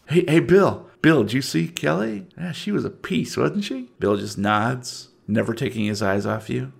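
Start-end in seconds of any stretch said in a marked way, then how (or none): 0.1-3.9 leer at Aaron like a smug yokel; Southern yokel. Acted. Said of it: "I'm from the South".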